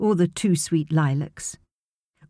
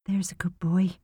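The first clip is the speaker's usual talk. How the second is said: guilty whisper; soft, guilty whisper to the boy; guiltily; 3 dB quieter than usual. Acted. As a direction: whisper it, ashamed of leaving him. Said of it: "she said guiltily".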